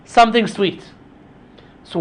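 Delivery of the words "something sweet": slow, emphatic, higher delivery; measured riddle reveal, pitched up; posing a riddle. Acted. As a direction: declaim it slowly like a challenge. Recites the riddle to the party.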